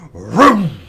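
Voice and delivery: loud revving vroom; engine vroom. Pretend. Imitating an Indy race car passing, loud vroom.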